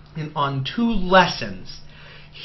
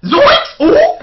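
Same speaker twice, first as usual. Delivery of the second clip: very high, loud Scooby-Doo style yelps; cartoon exclamations; 8 dB louder than usual. Joking at the rant with loud, very high cartoon yelps. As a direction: yelp like a cartoon coward.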